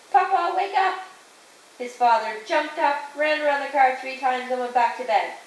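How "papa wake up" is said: raised, higher-pitched call to wake Papa; mild yell. A narrator's reading. Jason to his sleepwalking father, mild yell. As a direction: call out urgently without fully shouting. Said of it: "Jason yelled".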